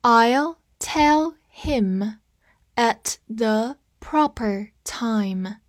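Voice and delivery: very slow, word by word; slow teacher read-along. Shown as a demonstration. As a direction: stretch it out for learners to repeat. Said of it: "跟我慢读一遍".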